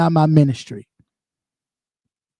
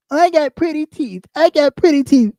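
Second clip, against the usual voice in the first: high, prissy mimic of the woman bragging; mock her preening boast; 6 semitones higher than usual. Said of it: "this bitch had the nerve to say she got pretty teeth".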